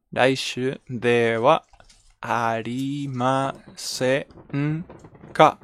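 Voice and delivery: very slow, drawn-out teaching delivery; slow model phrase. Shown as a demonstration. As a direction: spell it out slowly for learners. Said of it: "teacher models the phrase slowly".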